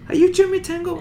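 high, excited recognition; surprised trooper. Retold or imitated. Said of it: "he goes".

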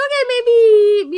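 laughing. Retold or imitated. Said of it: "And I was like".

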